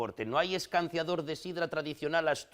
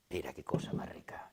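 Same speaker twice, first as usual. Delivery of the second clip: low, soft, whispered admiration; hushed, unhurried aside of relish; savouring whisper; 11 semitones lower, 7 dB quieter than usual. Acted. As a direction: whisper it as you admire the treat.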